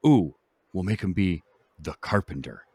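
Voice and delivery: ordinary talk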